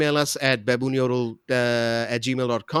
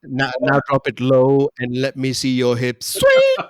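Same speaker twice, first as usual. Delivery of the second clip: mock Indian accent, squealing Sweet; hammed-up Indian accent; 5 dB louder than usual. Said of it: "that's some Indian accent savage".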